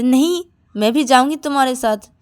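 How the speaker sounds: put-on a bossy little girl loudly refusing to stay behind; loud insistence. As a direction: insist loudly like a spoiled child.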